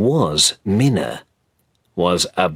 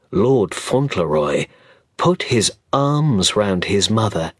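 ordinary delivery

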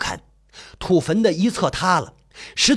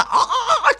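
high, stuttering cry of being choked; high strangled scream; faster than usual. Acted. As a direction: cry out as you are grabbed by the throat. Narrator being seized by the throat.

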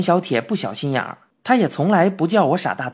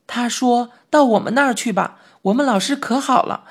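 a slightly higher, hurt schoolboy voice; aggrieved little boy; 5 semitones higher than usual. Acted. Mouse boy explains to his friend why he ran away, higher and hurt. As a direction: sound like a wronged kid pouring out his troubles.

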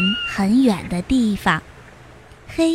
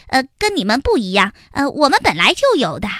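fast nervous little ant voice; hasty lie; much faster than usual. Acted. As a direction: hurry the lie along. Heihei keeps lying fast. (黑黑急急忙忙撒谎)

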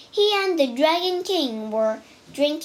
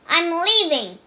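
angry exit; 4 semitones higher, 5 dB louder than usual. A narrator's reading. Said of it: "Wukong was angry".

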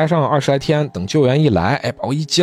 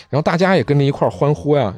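ordinary talk, nothing marked

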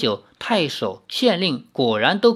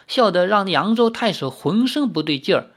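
ordinary delivery, nothing marked

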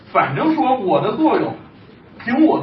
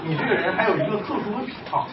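usual speaking voice

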